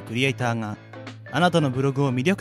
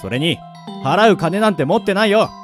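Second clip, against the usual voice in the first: raised, loud, exasperated retort; irritated broke man; 4 semitones higher, 4 dB louder than usual. Acted. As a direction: brush off the fortune-teller crossly. Irritated hero snaps at fortune-teller. (苛立って突っぱねる)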